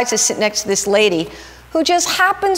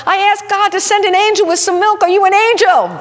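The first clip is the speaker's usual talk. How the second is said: much higher, louder joyful call from the kitchen; yelled out; 11 semitones higher, 4 dB louder than usual. Retold or imitated. Wife calling from kitchen to the stranger, joyful yell. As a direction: call it out, amazed and laughing. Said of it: "his wife in the kitchen yelled out".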